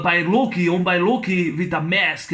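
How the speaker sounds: ordinary talk, nothing marked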